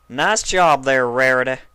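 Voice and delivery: loud, sarcastic scolding; sarcastic reprimand. A narrator's reading. Applejack reprimanding Rarity, loud. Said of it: "reprimanding her".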